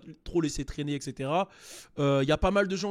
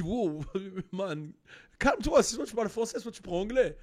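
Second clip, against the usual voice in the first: exasperated telling-off; 3 semitones higher than usual. Retold or imitated. Telling off code-switching speaker.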